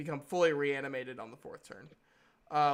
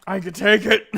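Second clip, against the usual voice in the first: defiant shout; 5 semitones higher, 15 dB louder than usual. Acted. Injured character refuses healing.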